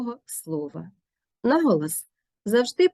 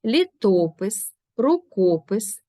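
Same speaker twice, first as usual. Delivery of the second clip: slow, louder ЛІтопис, РУкопис; stress before пис; 4 dB louder, slower than usual. Shown as a demonstration. Teacher drilling the stress before пис, slow and firm. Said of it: "наголос на голосному, що стоїть перед цим компонентом".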